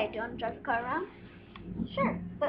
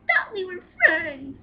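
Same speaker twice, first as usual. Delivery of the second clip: mock hurt; 5 semitones higher, 10 dB louder than usual. Doll acts hurt at friend.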